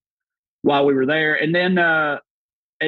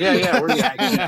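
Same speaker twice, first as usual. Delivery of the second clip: laughing; 7 semitones higher, slower than usual. Retold or imitated. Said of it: "So we're like".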